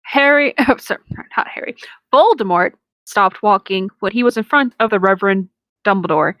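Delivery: ordinary delivery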